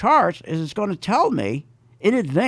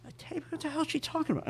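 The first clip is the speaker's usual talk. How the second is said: much softer, quick bewildered aside; muttered thought; 9 semitones higher, 14 dB quieter, faster than usual. Retold or imitated. Shopper thinking to himself about the clerk, quiet mutter. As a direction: mutter it to yourself, baffled.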